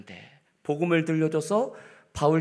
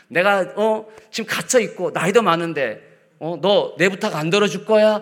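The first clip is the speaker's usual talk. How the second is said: higher, louder mock pleading; guilt-tripping Paul; 6 semitones higher, 7 dB louder than usual. Paul pressing Philemon for his favor, mock pleading. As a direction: lay on the guilt, half teasing.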